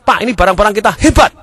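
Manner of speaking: high, loud boast; exaggerated excited seller pitch; hammed-up sales enthusiasm. Acts out matching excited seller.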